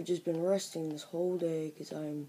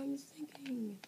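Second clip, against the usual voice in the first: higher, unvoiced scheming whisper; sly whisper; 8 semitones higher, 7 dB quieter, slower than usual. Real, not acted. Gamer to viewers while walling himself in: sly whisper. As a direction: hush it like a secret plan. Plotting to block the mobs.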